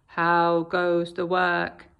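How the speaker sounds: low, slow farmer call; lazy farmer calling. Acted. Lazy farmer calling from bed to the duck. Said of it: "called the farmer".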